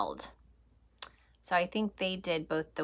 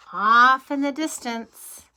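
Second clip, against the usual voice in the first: louder, drawn out suspense; dramatic build; 4 semitones higher, 8 dB louder, slower than usual. Acted. Reading to children, building suspense before the train. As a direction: swell the voice as the train appears.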